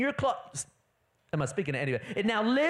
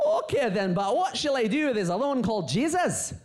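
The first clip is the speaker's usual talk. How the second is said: Scottish Pilate; 3 semitones higher, 3 dB louder, faster than usual. Acted. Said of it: "So I'm gonna be Pilate".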